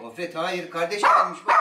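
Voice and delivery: normal talking voice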